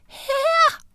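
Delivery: meget lys, langtrukken bittelille stemme; pibende. A narrator's reading. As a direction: pib som en bitte lille frø. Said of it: "pev Oscar". (tyndt, højt piv)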